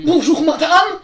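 loud, hammed-up prewar German accent, laughing through it; old German accent. A caller greets the switchboard in a prewar German accent. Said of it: "un mec avec un accent allemand d'avant-guerre".

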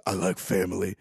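low, quiet mock Vin Diesel; low hushed parody of Vin Diesel. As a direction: drop the voice for a gruff action hero. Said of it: "And he goes".

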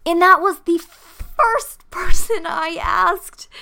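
high, loud, drawn-out excitement; amazed emphasis. Real, not acted. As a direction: stretch it out loudly in real amazement. Amazed at her first try working.

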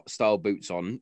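ordinary talk

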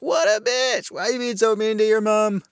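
high-pitched mock outrage at the daughter; mock scolding. Viewer scolds the daughter for meanness, mock outrage. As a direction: put on a high, indignant scolding voice.

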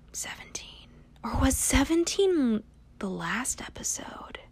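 hushed whisper to herself. Real, not acted. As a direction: whisper it, unsure.

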